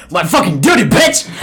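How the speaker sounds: sudden high-pitched shout; in-character shout, leaping up in pitch; acted outburst. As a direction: erupt from calm into a loud yell. Akira bursts out at William.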